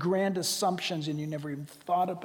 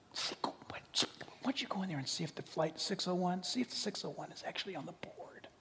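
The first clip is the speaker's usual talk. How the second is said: hushed, unvoiced whisper; nervous whispered urging; 10 dB quieter than usual. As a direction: whisper like not wanting to be overheard. Scared people whispering at airport.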